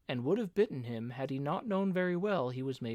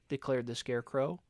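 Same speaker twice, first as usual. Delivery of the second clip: ordinary delivery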